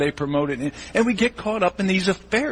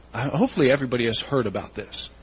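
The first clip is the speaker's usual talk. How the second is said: ordinary talk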